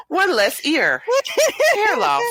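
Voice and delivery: high, giggling through the words; laughing hard while talking. Real, not acted. Laughing at side effects list.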